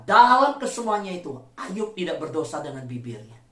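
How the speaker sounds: normal talking voice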